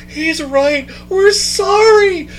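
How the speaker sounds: crowd's sheepish reply. Retold or imitated. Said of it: "our collective response was".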